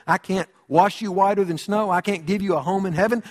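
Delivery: ordinary talk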